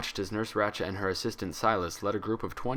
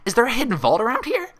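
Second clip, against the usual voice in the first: excited Pinkie; 9 dB louder than usual. Acted. Pinkie excited about vault.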